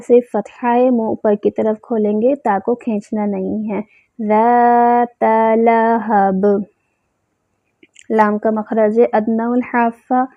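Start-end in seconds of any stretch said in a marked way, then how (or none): 4.3-6.4 slow drawn tajweed chant; measured recitation; Quran recitation. Shown as a demonstration. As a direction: recite slowly, holding the vowels. Recites verse slowly.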